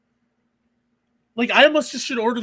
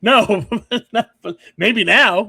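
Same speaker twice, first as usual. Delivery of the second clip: louder words broken by a real laugh; giggling out a no, then slowing down; 7 dB louder, much slower than usual. Real, not acted. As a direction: laugh through it as you answer. Laughs at the Japanese joke.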